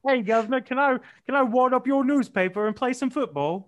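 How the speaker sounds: loud, high put-on Cockney; cheeky street-kid Cockney, loud; Cockney urchin. Mocking a London orphan, loud Cockney. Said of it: "a Dickensian orphan grew up".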